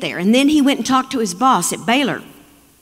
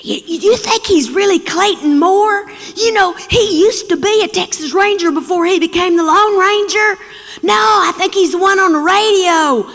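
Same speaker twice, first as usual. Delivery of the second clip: put-on high, louder kid voices chattering; excited kids; 9 semitones higher, 5 dB louder than usual. Kids whisper-argue whether dad is the real Lone Ranger, high and excited. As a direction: play a crowd of thrilled children. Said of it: "I heard the kids around us saying".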